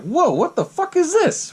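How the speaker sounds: high, slow stunned reaction; mock shock. Reacting to Billy popping up at the window, high mock shock. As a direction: draw it out in high disbelief.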